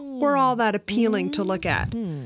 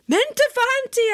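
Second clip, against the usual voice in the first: high-pitched insistent cry; 12 semitones higher, 4 dB louder than usual. A narrator's reading. Customer refusing talk of marriage, crying out. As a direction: protest in a high, comic wail. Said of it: "I cried again".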